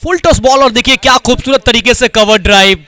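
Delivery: loud, high, thrilled call of a four; excited cricket commentary. Real, not acted. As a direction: shout the boundary with delight. Boundary hit, live commentary.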